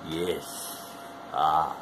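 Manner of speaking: ordinary talk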